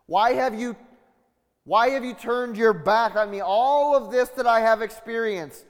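high, loud pleading question to God; plead, feeling abandoned; anguished plea. Acted. Voicing Jesus' question to God.

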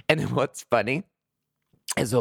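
normal talking voice

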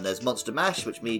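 normal talking voice

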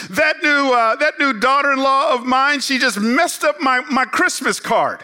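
mildly imitating an upset churchgoer; peeved older lady. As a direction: lightly voice her grumbling complaint. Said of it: "she's ticked off, and she's peeved".

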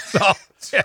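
ordinary talk

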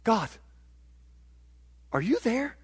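mildly pleading, searching question; psalmists' questioning. Acted. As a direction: ask haltingly, with a long pause after God. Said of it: "Here's what they're asking".

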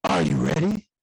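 low growled wrestler call; Triple H voice. Acted. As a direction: do a Triple H "are you ready". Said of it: "in my Triple H voice".

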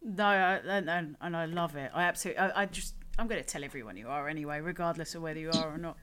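ordinary talk

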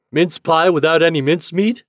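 high, loud teasing question; captain's shouted jest; called out, teasing. A narrator's reading. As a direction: holler a joke at a boy on shore. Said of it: "he called when he had repeated the joke".

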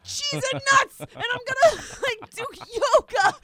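loud, high, hammed-up overexcited husband; mock-manic shouting of wild resolutions; mocking husband's big plans. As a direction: shout out his grand promises in a silly high voice. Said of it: "where you're just like".

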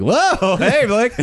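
high teasing call, mocking a friend; mocking greeting. Mocking swollen friend at practice.

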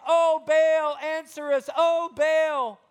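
lightly hammed-up wail to a false god; mock pleading. Imitating the prophets of Baal, mock pleading call. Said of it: "they're sitting there saying".

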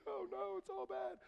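high-pitched comic panic; mock panic. Kid sprinting towards the car, mock panic. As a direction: squeal it like a kid in trouble.

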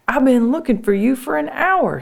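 high-pitched, aggrieved brother; exasperated complaint. Acted. Brother who searched an hour scolds sister, exasperated. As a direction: complain at her, voice pushed up high.

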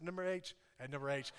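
ordinary talk, nothing marked

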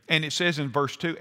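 ordinary talk

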